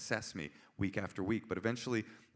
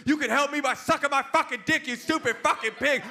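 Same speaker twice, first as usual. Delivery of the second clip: high, screamed insult; enraged caller; 8 dB louder than usual. Retold or imitated. Interpreter voicing caller's reply to cop, furious scream. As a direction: scream it with total abandon.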